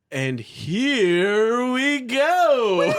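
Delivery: big hyped launch with music; hyped game intro. Acted. Host to the players, hyped launch of the game. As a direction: kick off the show with a bang.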